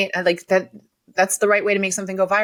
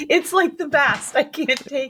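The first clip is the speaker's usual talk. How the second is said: swooning; 6 semitones higher, slower than usual. Real, not acted. Gushing over his accent.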